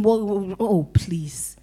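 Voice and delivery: hushed, half-whispered grumble; reluctant thinking aloud. Acted. Hesitant giver reasoning with themselves, reluctant murmur. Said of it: "Don't give hesitating and thinking".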